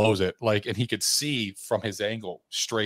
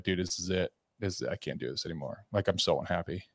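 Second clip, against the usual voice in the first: low, quiet retold confession; hushed, dejected admission; despairing; 3 semitones lower, 8 dB quieter than usual. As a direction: confide defeat to a friend, softly. Despairing call to a buddy, retold.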